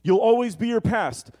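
loud, high, stressed ALWAYS; the lying voice, pressing hard; accusing voice. Acted. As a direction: hammer the word always like an accuser. Voice of the accuser again.

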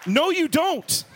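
high, slow comic retort; indignant denial. Retold or imitated. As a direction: deny it in exaggerated protest. Retort to drunk seatmate's claim.

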